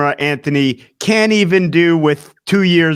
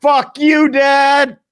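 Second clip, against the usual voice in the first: higher-pitched mock outburst at dad; mock yell; 8 semitones higher, much slower than usual. Son jokingly curses the dad calling his phone, mock yell. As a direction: throw a playful curse high at an absent father. Mock answer to dad's call.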